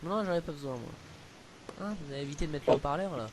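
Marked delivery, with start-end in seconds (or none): none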